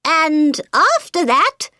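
high, slow hatter; high nervous hatter; Mad Hatter voice. A narrator's reading.

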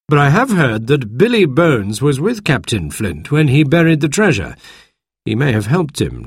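doctor lays out what he has heard; lightly voiced doctor. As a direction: speak as the measured, explaining doctor. Doctor voiced over many lines.

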